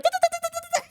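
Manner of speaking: very high, soft babbling; excited kid babble. Retold or imitated. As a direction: babble like a happy toddler. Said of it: "And they were like".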